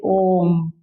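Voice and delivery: ओम with the vowel held three times as long; drawn-out pluta vowel. Shown as a demonstration. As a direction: stretch ओम slowly, like a chant. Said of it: "तीन गुना समय लगता है". (ओम को तीन गुना लंबा खींचकर बोलें)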